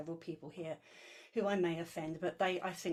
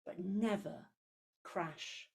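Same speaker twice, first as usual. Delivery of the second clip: poem reading; 2 semitones lower than usual. Acted.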